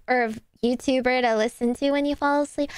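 ordinary talk

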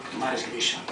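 ordinary talk

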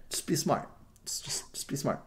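soft whispered repeat; hushed afterthought; whispered aside. Real, not acted. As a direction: whisper it, half to yourself.

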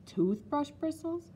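high, drawn-out "Toothbrush bristles" as a question; incredulous cry. A narrator's reading. Child doubts Nanny's explanation, crying it out incredulously. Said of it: "I cried".

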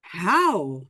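one-word startled question, swooping up and back; lightly acted astonishment; mild narrated gasp. A narrator's reading. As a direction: let the pitch leap in mild mock shock. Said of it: "she gasped".

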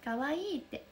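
normal talking voice

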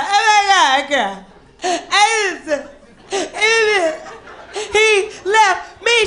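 hammed-up high, loud sobbing; crying, distraught. Dumped comedian on the phone to a friend, mock crying. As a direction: wail the words between mock sobs. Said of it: "I'm crying and distraught".

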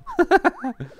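high, squealing giggle. Real, not acted. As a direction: giggle at the joke.

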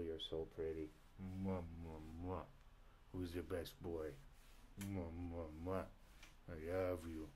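soft cooing pet voice. Real, not acted. Cooing softly at the cat while trying to work.

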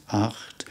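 "Acht" whispered, with a raspy ch at the back of the throat; whispered German Acht. Shown as a demonstration. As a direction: breathe the ch in "Acht". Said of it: "at the back of our throats".

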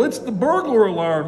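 officer's excited yell; shouted alarm. A narrator's reading. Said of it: "he shouted".

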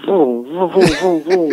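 vroom of a revving motor; buzzing engine sounds with the lips; engine noise imitation. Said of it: "all I hear is, like".

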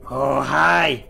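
normal talking voice

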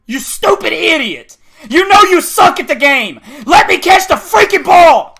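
loud, high-pitched angry yelling; raging, building to a shout; furious gamer rage. Real, not acted. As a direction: yell at the opponent in real frustration. Raging at the opponent and game.

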